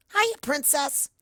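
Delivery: very high, loud bouncy greeting; too-cheerful shout. Acted. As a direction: hail the princess with way too much cheer. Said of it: "shouts Pinkie, entirely too cheerful".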